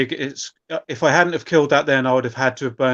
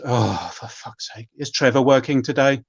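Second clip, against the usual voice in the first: exasperated dog. Acted. Dog grumbles at the shopkeeper in a fed-up voice. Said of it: "the dog looks back at him and says".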